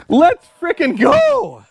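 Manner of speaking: slow, high, laughing; drawn-out joyful cheer. Real, not acted. Celebrating engine start.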